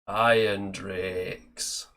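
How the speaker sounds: very slow, drawn-out Yorkshire dwarf; Yorkshire accent. Acted. Host quoting a Total War dwarf unit, slow Yorkshire accent. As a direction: stretch each word out in a Yorkshire accent. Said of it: "Yeah, definitely Yorkshire".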